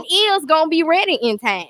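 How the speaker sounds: high, emphatic self-declaration; affirmation. Retold or imitated. Guest re-enacting her affirmation for the host, emphatic. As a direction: proclaim it as certain. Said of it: "I did my affirmation".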